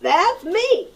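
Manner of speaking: high hen voices. Acted. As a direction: chirp it like flustered hens. Hens voiced in read-aloud.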